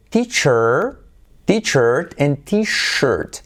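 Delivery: slow "teacher" with ch, "t-shirt" with long sh; CH against SH. Shown as a demonstration. Teacher helping Latin American learners, slow ch versus sh. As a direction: draw out the ch and sh contrast. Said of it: "diferenciando la S H y la C H".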